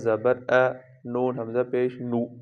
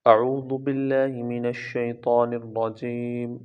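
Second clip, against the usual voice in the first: ordinary talk, nothing marked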